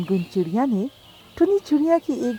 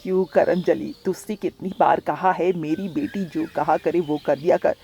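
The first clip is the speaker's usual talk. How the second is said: lower, stern stepmother voice; scolding stepmother; 5 semitones lower than usual. Acted. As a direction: scold the girl, pitched low. (धीमी नीची आवाज़ में डाँटती सौतेली माँ)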